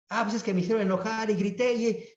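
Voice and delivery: high, hurried self-justifying whine; mock excuse-making. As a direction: rush out the excuse in a high imitation. Acts out an excuse for anger. (excusa imitada, aguda y apresurada)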